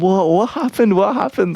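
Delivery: high-pitched, eager imitation of a girlfriend; mock excited squeal of curiosity; eager curious girlfriend. Girlfriend asking about his silly adventure, high mock excitement. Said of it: "she's gonna be like".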